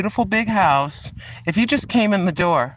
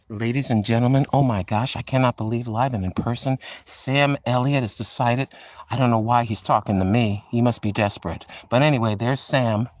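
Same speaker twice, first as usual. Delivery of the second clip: Sam Elliott voice; 6 semitones lower than usual. Pretend. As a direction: lower voice, a mock Western movie star. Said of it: "I gotta work on it, his accent".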